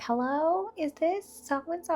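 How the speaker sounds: put-on high, slow childish phone voice; child prank-calling dad's girlfriend; little girl on the phone. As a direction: play a kid calling a stranger. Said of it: "being like".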